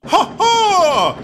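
very high, loud mocking halloo laugh; taunting laugh. Gordon gloating at Edward behind him, loud high laugh. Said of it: "Gordon hallooed".

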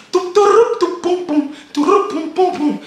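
high-pitched ringtone mimicry; imitated phone ringtone. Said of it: "my phone goes off".

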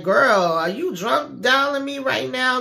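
high, loud, drawn-out incredulous question; mock disbelief. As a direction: imitate someone taken aback by a drunk caller. Acting Donald's disbelief at drunk call.

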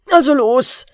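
loud, high impatient call. Acted. The king impatiently presses the dwarf to start. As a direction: hurry him up sharply. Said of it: "rief der König ungeduldig".

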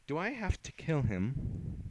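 slow, high menacing question. Acted.

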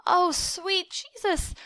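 high, drawn-out wail; panicked wail. A narrator's reading. Boy who just fired a loaded rifle, wailing. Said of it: "I wailed".

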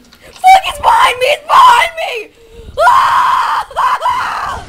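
screaming, high and very loud; being chased. Real, not acted. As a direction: shriek as the zombie grabs you. Zombie attacks from behind.

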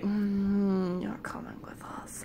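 slow, creepy whisper; hushed menace. Retold or imitated. Gnomes come to take the dreamer, slow menacing whisper. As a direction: whisper the threat slowly.

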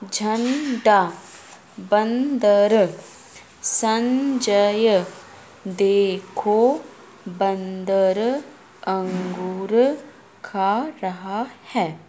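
each word drawn out very slowly; slow teacher read; slow reading aloud. Shown as a demonstration. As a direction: model the words slowly for a child. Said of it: "reading words slowly for kids".

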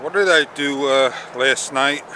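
ordinary talk, nothing marked

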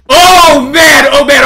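startled shout. Real, not acted. Pigs rush in.

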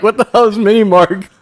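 high, loud baffled question; baffled voter. Retold or imitated. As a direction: shout up high in disbelief.

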